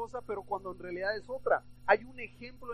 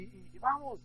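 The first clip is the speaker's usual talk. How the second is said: ordinary talk, nothing marked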